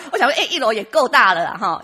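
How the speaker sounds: talking through loud laughter; laughing. Real, not acted. Laughing loudly while telling her husband's first-floor answer. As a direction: still laughing as she speaks.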